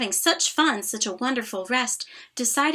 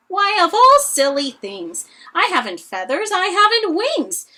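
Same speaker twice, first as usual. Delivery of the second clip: laughing elephant; 7 semitones higher, 7 dB louder than usual. Acted. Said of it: "The elephant laughed".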